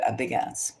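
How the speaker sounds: normal talking voice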